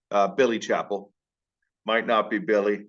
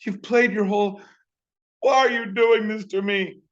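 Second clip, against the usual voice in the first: high, loud parental wail; hammed-up parent taking it personally; upset parent; 11 semitones higher, 4 dB louder than usual. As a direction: protest loudly in a high, dramatic parent voice. Imagined upset parent confronts kid.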